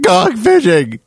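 imitating the cracked voice; voice cracking. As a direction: crack the voice on going. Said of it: "his voice cracked".

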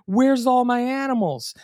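high, alarmed call; mock frantic wife. Host voicing the wife hunting her missing animals, high and frantic. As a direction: pitch it up like a panicked search. Anita searching for pets.

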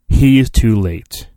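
ordinary talk, nothing marked

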